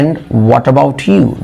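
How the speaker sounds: "And what about you?" said very slowly, each word drawn out; slow demo. Shown as a demonstration. Teacher slowly modelling a polite reply for students. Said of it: "ये भी बोल सकते हैं".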